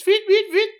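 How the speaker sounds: high, loud electronic chirps; imitating chirping. Guest imitating the mystery chirping from the toilet, high mock beeps. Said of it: "chirping, like".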